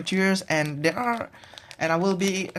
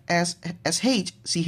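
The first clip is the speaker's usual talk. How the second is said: ordinary talk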